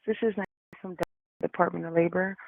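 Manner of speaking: ordinary delivery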